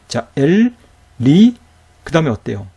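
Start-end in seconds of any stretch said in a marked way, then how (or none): 0.3-1.6 엘 closed with L, pause, louder 리; higher-pitched 엘, then 리, each separate; syllable-by-syllable demo. Shown as a demonstration. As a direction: model 엘 and 리 one syllable at a time. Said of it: "이렇게 읽어야 되는 거죠".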